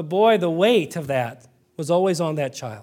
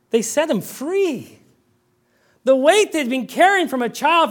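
fervent preaching; 10 semitones higher than usual. Acted. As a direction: preach it higher and higher toward freedom. Preaching builds to full force.

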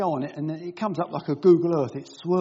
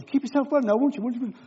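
mock caller voice; 8 semitones higher, 3 dB louder than usual. Mimics the blood service caller.

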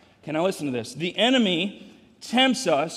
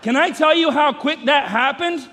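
higher, louder, laughing through it; laughing disbelief; 6 semitones higher, 5 dB louder than usual. Real, not acted. Father laughing to congregation about his grown son. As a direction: laugh at how fast time went.

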